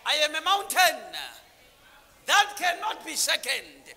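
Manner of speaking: loud, high fervent sermon; preacher in full flow; full-force preaching. Real, not acted. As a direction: drive the story home to the crowd.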